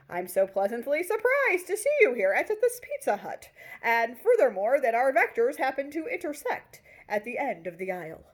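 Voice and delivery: high, loud overplayed delight; mock pleasantly surprised. Storyteller hams up his surprise at bumping into Lily. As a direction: act thrilled by a chance meeting. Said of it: "as if to say".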